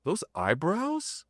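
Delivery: high, rising astonished questions with a gasp; shocked disbelief. Retold or imitated. Seeing his own face appear in the vision, high astonished gasping. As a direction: sound like you can't believe your eyes. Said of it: "And I'm like".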